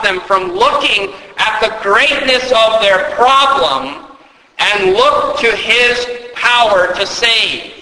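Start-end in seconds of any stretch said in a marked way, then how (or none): none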